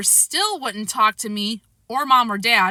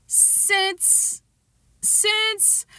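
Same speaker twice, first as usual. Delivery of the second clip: stalling; 12 semitones higher, 4 dB quieter than usual. A narrator's reading. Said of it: "he was stalling".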